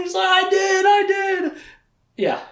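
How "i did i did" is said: high, loud, frantic insisting; panicked protest. Retold or imitated. Denna defending herself to Darken Rahl, a mock high panicked yell. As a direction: squeal it out in a panic.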